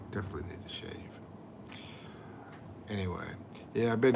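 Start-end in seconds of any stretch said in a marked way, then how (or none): 0.1-1.2 muttered aside. Real, not acted. Muttering to himself.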